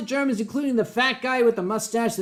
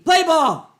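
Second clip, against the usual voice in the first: shouted call; 7 semitones higher, 6 dB louder than usual. Acted. Calling out loudly.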